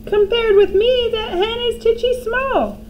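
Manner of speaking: high smug crone voice, slowing at the end; grandma boasting about her height. A narrator's reading. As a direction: gloat like a vain old woman.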